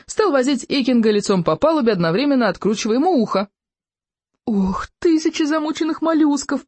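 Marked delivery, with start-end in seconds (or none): 4.4-6.7 high-pitched, dismayed groan. A narrator's reading. Friend can't bear watching the beating, high groaning. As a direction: wail an oath in dismay. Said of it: "простонал Рыбьенок".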